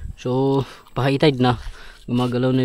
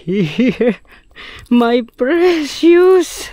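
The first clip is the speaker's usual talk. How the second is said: high, slow creepy creature voice; Gollum voice; 12 semitones higher, 5 dB louder, much slower than usual. Pretend. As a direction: croon it like a greedy creature. Gollum voice over the fish.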